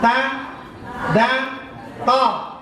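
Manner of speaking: "ta, da, to" said with the tongue on the side, not the tip, the wrong way; teacher demonstrating the forbidden way to say "ta, da, to"; wrong pronunciation demo. Shown as a demonstration. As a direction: show the mistake on "ta, da, to" by pushing from the edge of the tongue. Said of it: "Tidak boleh".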